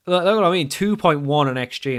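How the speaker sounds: ordinary talk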